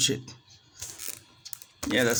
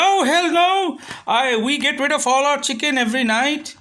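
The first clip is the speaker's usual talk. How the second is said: high, loud mock seller's protest; indignant seller; 8 semitones higher, 4 dB louder than usual. Chicken seller denying the complaint, indignant. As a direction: put on the seller's high-pitched outrage. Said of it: "And he's like".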